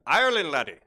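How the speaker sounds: playful Irish lilt on the name; mock Irish accent. Host echoes answer Ireland, in mock Irish accent. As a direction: put on a jokey Irish accent.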